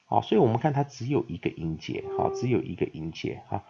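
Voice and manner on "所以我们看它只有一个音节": ordinary talk, nothing marked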